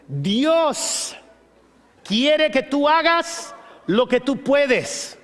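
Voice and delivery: high, slow, deliberate; slow emphasis. Real, not acted. Preacher stressing the lesson to the church, slow. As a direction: spell out every word. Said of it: "slow emphatic point".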